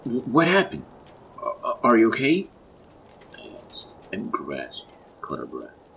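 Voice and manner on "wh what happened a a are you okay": loud, high-pitched alarm; frantic check on someone possibly hurt; panicked stammer. A narrator's reading. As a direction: stumble over the first words in fright. Fears his wife got shocked.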